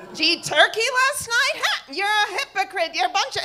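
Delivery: high-pitched mocking husband rant; high, accusatory imitation. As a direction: attack like an indignant heckler. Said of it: "the husband says".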